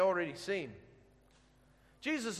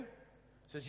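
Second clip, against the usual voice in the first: breathy low whisper; 3 semitones lower, 17 dB quieter than usual. Real, not acted. Setting up Jonah's reply, a brief whisper. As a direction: drop to a whisper.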